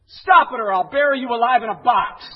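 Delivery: very loud, high comic yell; shouted threat. Retold or imitated. Psychiatrist's ten words to waiting patient, shouted threat. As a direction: bellow the punchline as a mock threat.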